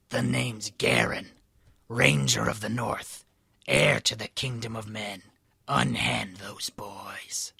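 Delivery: slow, raspy hero half-whisper; measured, hushed ranger voice; Garen. A narrator's reading. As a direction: rasp it slowly, ending in a whisper. Ranger introduces himself in story.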